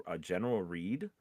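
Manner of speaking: normal talking voice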